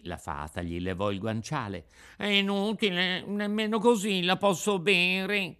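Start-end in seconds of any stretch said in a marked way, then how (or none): 2.2-5.6 put-on high-pitched boyish complaint; fussy puppet child. Puppet making excuses to the Fairy, whiny.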